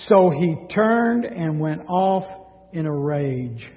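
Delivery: ordinary delivery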